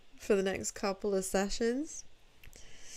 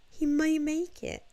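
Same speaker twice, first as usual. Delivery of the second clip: high, louder burst of hope; mock hopeful; 8 semitones higher, 4 dB louder than usual. Streamer retelling her doomed hope for Gundam, mock hopeful. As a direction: lift it high with hammed-up hope. Retold hopeful thought, repeated higher.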